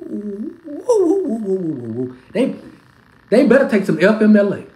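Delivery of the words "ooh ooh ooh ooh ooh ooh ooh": mocking oohs. Mocking cooing sounds.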